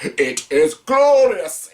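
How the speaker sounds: Shao Kahn. Retold or imitated. Quoting the over-the-top movie villain, slow hammed-up boast. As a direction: ham up a gloating movie villain, slowly. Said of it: "bald dude who's over the top".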